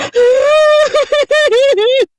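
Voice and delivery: high, loud shrieking burst of laughter. Real, not acted. Mom cracking up at her daughter's fury, loud shriek of laughter. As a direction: let a howl of laughter rip out.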